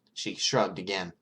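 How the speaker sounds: ordinary delivery, nothing marked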